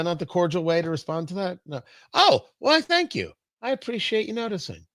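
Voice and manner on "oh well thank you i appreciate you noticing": high, sugary, mock-gracious thank-you; preening, lightly hammed-up gratitude; coy mock thanks. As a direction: play it like a flattered diva. Coy mock-gracious reply to compliment.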